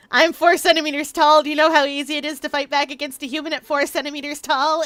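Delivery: indignant protest. Acted. Tiny creature explaining why fighting a human is hard, indignant. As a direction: protest loudly at the absurdity of it.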